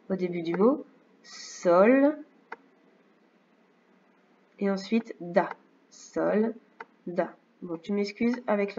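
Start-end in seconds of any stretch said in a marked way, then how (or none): 1.2-7.3 slow, one syllable at a time; sounding out syllables. Shown as a demonstration. Teacher sounds out soldat for a pupil, syllable by syllable. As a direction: break "soldat" into "Sol" and "da" with pauses. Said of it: "On entend s".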